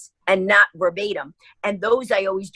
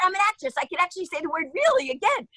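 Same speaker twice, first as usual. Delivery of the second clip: indignant insistence; 9 semitones higher, faster than usual. Retold or imitated. Insisting to the crew.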